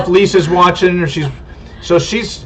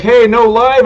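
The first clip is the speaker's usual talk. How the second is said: higher, louder mock complaint; imitating viewers' protest; 7 semitones higher, 4 dB louder, slower than usual. Viewers texting about no livestream, imitated protest. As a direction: pipe up like a viewer calling out. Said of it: "Everybody's like".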